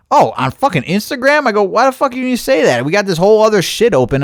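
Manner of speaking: high-pitched, fast retold indignation; worked-up mock complaint, pitch jumping up; exasperated retort. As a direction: replay your exasperation at the support guy. Retold exasperated reply to support agent.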